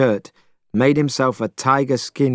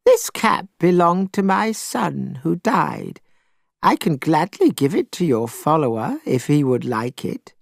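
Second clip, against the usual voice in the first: put-on old woman voice; 5 semitones higher than usual. A narrator's reading. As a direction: speak gently as a grieving old woman.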